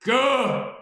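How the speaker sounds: "Gur" blurted high and very loud; emphatic syllable. Shown as a demonstration. Following instructions to add emphasis, emphatic shout. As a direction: belt out "Gur" with full force. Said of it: "add emphasis to say".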